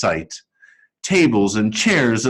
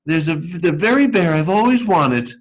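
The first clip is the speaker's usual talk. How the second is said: put-on 1 little girl voice; 7 semitones higher than usual. A narrator's reading. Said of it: "she said".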